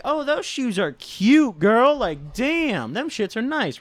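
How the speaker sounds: high, drawn-out camp gushing; put-on flamboyant compliment, pitched high. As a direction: gush with sassy, exaggerated flair. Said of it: "getting a little flamboyant with the comments".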